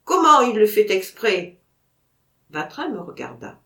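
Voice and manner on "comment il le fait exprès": loud, disbelieving question; incredulous outcry. Acted. Vatrin to the narrator, unable to believe the dog is doing it on purpose, loud. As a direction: throw the disbelief back hard.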